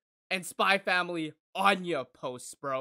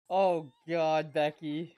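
ordinary delivery

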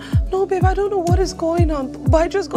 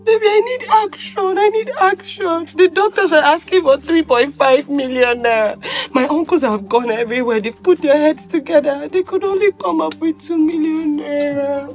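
tearful pleading. Acted. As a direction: pour out desperation through tears. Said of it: "stop crying now".

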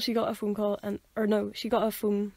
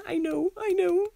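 higher, slow, patting comfort; soothing sympathy; 9 semitones higher, 3 dB louder, slower than usual. Retold or imitated. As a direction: comfort her slowly, like a hand pat. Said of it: "sort of just going".

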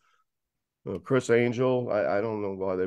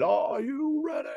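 high, hyped announcer call; arena announcer; 14 semitones higher than usual. Retold or imitated. Fan imitating the concert's opening announcement, high hyped call. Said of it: "you get the announcement".

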